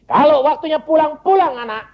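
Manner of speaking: put-on high-pitched, emphatic address to a child; retold parental release. Father waiting at three o'clock, calling to child.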